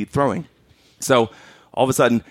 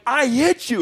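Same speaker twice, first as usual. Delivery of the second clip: high frustrated shout; 13 semitones higher than usual. Retold or imitated. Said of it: "I'm like".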